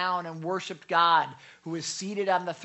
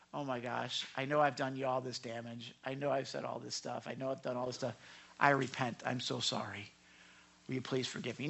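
soft, lower apologetic plea; soft, lowered voice of someone sorry for the harm they caused; 6 semitones lower, 8 dB quieter than usual. Retold or imitated. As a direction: play the remorseful friend asking forgiveness quietly. Voicing repentant person apologizing.

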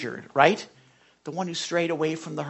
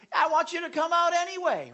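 high pleading; 14 semitones higher than usual. Acted. God voiced pleading.

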